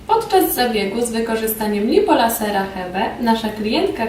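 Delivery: normal talking voice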